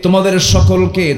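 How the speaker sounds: high, ringing sermon proclamation; preaching at full force. Acted. Preacher declaims the verse on death to the gathering. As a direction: lift the pitch high and declaim it to the crowd.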